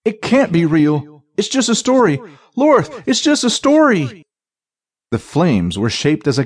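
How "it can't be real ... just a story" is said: high, climbing desperate insistence; panicked denial. A narrator's reading. Thief reassuring himself before a flaming monster, panicked denial. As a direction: talk yourself out of it, pitch climbing.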